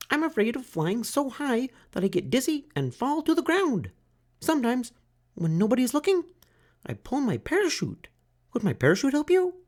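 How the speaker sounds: very high, chirpy bird; tiny bird voice. A narrator's reading. Bird tells Franklin her fear, very high. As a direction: squeak it like a small bird.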